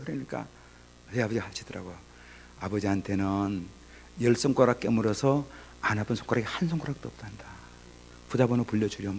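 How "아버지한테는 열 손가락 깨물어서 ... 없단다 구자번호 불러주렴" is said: soft, unhurried fatherly voice with pauses; tender father. Retold or imitated. Father reconciling with his son on the phone, tender. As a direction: gently coax a hurt child, keeping it quiet.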